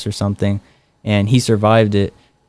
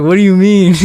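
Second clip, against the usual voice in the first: high, louder, laughing through the words; laughing disbelief; 10 semitones higher, 7 dB louder than usual. Retold or imitated. Retold baffled reply, laughing.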